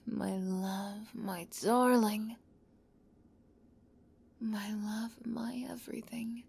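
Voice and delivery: ordinary talk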